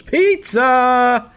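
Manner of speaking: high, loud, drawn-out cry of delight; excited shout. Real, not acted. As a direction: belt it out like a joyful discovery. Exclaims on finding pizza.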